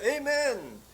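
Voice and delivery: high, loud cry of "Amen"; shouted amen. Retold or imitated. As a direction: cry "Amen" like a first word breaking free. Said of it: "Sumigaw siya".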